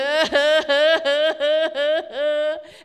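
loud retold laugh; amused chuckle; pastor laughs. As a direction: laugh at her. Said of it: "And he's".

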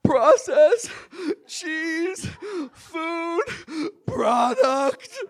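high, loud words broken by sobs; crying. Retold or imitated. Child reads the label aloud while sobbing. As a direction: choke each word out between breaths. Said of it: "I said between catching breaths".

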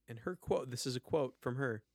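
ordinary delivery

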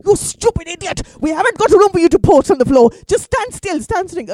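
loud, high mock-furious scolding; scolding grandmother. Grandmother slaps her fainting grandson, loud acted scolding. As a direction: bawl him out as the grandmother. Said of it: "She slaps him really hard".

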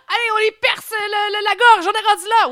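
high, loud, urgent, laughing; excited shouting. Pretend. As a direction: shout it like a panicked paramedic. Playacting an emergency rescue.

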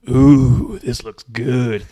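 drawn-out, loud goofy delight; loud, slow put-on voice; dim Ernest impression. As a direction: ooh and aah slowly, like a clueless fool. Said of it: "Ernest just goes".

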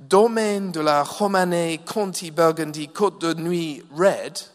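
wine name in a loud, hammed-up French accent; mock French accent. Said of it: "my best French accent".

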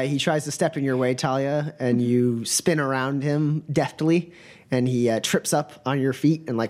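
ordinary talk

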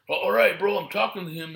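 arguing fan. Retold or imitated. Fan arguing back at staff.

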